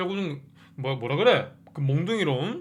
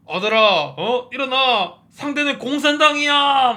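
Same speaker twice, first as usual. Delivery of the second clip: a father's loud, high battle cry to his son; rousing shout; 8 semitones higher, 8 dB louder, slower than usual. Retold or imitated. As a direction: yell him back onto his feet. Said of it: "막 이래". (아들을 일으키는 아버지의 우렁찬 외침)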